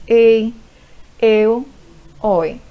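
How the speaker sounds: "ei, eu, oi" loud, each with an open first vowel; open diphthongs. Shown as a demonstration. Teacher demonstrating open diphthongs to students, "ei, eu, oi" loud and open. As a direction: set "ei, eu, oi" apart with wide-open vowels. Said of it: "Ditongos abertos".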